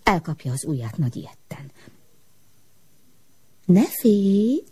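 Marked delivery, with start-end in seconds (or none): 3.6-4.6 whispering voice. A narrator's reading. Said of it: "suttogta egy hang".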